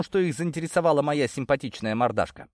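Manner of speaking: usual speaking voice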